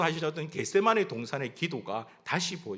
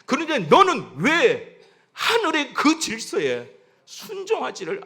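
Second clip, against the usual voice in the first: building rebuke; 5 semitones higher than usual. Acted. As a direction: drive each question harder, as God reproving. God's rebuking answer, acted, building.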